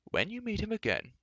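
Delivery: put-on high-pitched old man's interjection; admonishing old enchanter. Old enchanter correcting a rash youth, admonishing. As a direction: take over his sentence to correct him.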